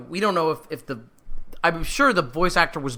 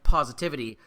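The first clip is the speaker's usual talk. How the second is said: ordinary talk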